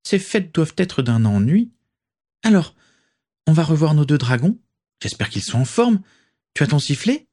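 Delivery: lightly voiced friend, eager for the dragons; young witch voice. A narrator's reading.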